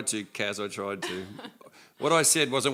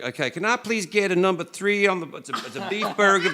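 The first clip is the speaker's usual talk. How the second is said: high, louder mock American accent; put-on American accent; 8 semitones higher, 5 dB louder than usual. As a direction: order fast food in an overdone American accent. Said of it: "You put the accent on".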